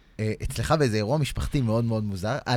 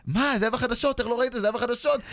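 mock yelling crowd; 10 semitones higher than usual. The queue in his dream yells at him, in a high mock yell. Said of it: "והם צועקים עליי".

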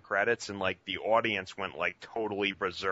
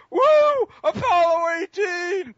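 mock yell; 12 dB louder than usual. Imitating the same heckler whooping at a horror trailer. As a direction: whoop it like an overeager fan. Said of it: "the same guy went".

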